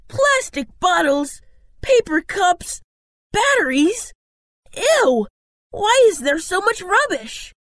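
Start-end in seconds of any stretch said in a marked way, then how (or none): 4.8-7.5 high-pitched grossed-out exclamations; disgusted. Acted. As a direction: recoil from a stinking pile of rubbish. Team disgusted by the stinking rubbish.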